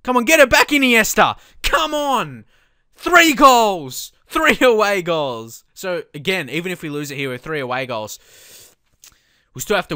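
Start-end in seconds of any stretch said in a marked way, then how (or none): 0.0-5.6 high, loud urging of the players; urgent cheering. Real, not acted. Gamer urging his strikers on, loud cheering. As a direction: cheer them on to score.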